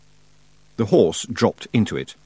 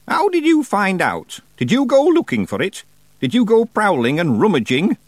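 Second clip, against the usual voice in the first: put-on stern old witch; 11 semitones higher, 5 dB louder than usual. A narrator's reading. Miss Treason interrogates.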